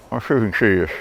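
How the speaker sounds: talking around a flashlight in the mouth. Real, not acted. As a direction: speak with something held in your teeth. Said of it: "I'm talking with a flashlight in my mouth".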